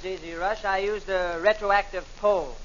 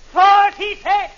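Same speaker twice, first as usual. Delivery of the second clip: loud, slow, high outrage; comic Irishman shouting at the barber's price; outraged shout; 8 semitones higher, 11 dB louder, much slower than usual. Acted. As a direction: bellow the price in disbelief.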